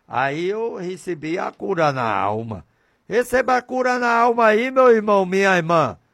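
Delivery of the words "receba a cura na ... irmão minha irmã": fast, louder call to the listeners; preaching blessing. Acted. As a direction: proclaim healing over them, quick.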